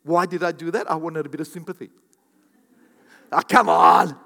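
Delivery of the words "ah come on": loud drawn-out groan of protest; exasperated exclamation. Acted. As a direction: cry it out to the room. Loud exclamation begging for sympathy.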